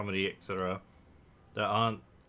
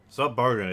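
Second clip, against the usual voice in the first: NOT Bogan said much louder and higher; emphatic correction; 3 semitones higher, 8 dB louder, faster than usual. Real, not acted. Streamer corrects his own accent comparison, loud and emphatic.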